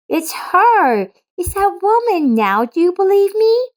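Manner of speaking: put-on a girl urging her dad to believe her; excited little girl. Laura excitedly points out woman.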